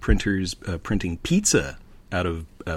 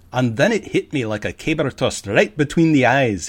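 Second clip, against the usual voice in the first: put-on Scottish accent; 3 semitones higher, 4 dB louder than usual. Quoting the Scottish CEO.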